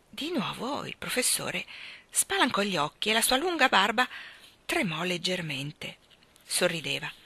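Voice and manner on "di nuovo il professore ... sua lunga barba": ordinary delivery, nothing marked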